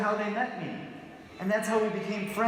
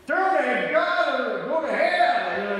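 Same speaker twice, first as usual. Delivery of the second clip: louder, higher, slow hammed-up preacher shouting; mock street preacher; 4 semitones higher, 5 dB louder, slower than usual. Mocking a street preacher answering "peace", slow and loud. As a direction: bellow it like a sidewalk preacher. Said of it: "Go to a street preacher and say".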